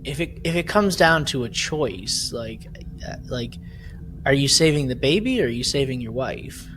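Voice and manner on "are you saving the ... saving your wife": ordinary talk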